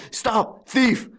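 very high cry after a thief; bellowed alarm. A narrator's reading. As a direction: shout to halt a fleeing thief. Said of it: "bellowed old Goussot".

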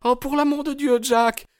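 distraught cry. A narrator's reading. Hetty begging Jack not to fight, distraught. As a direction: cry out in dismay. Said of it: "s'écria la pauvre Hetty bouleversée".

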